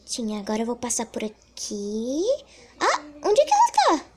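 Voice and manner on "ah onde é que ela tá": high fast startled question; alarmed high cry. Real, not acted. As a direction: squeal in surprise. Lost target.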